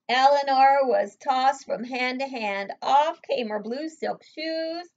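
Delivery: put-on lively read-aloud to a child; story narration. A narrator's reading. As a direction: read it big and slow for a little listener. Reading picture book aloud.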